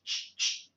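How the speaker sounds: ch, ch, ch as voiceless hissed bursts; ch, ch, ch voiceless sound demo; isolated ch sound. Shown as a demonstration. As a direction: repeat the bare ch sound three times, no voice.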